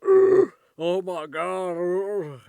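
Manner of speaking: loud, high, drawn-out gasping groan; hammed-up out-of-breath wail; mock winded. Joking about running upstairs for the mail, mock winded. Mimics being winded after stairs.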